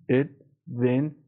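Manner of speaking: اد, ون slowly, with a gap between; syllable by syllable. Shown as a demonstration. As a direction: split the syllables اد and ون apart. Teacher breaks word into syllables.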